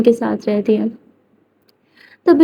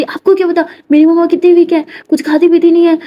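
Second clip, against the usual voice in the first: worked-up protest; 6 semitones higher, 4 dB louder than usual. Acted. Kiran argues with the doctor.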